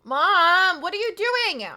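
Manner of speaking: loud, high protest at Mom; exasperated yelling. Real, not acted. Streamer scolding mom for gifting subs, exasperated yell. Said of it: "I'm not yelling at my mom".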